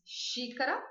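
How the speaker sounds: ordinary talk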